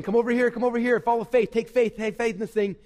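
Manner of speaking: lightly voiced monkeys beckoning; coaxing monkeys. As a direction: lure them along like an excited monkey. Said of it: "The monkeys are taking us this way, saying".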